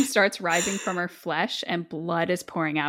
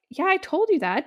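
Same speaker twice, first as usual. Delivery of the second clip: mock offhand; 12 semitones higher, 3 dB louder than usual. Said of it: "And he's like".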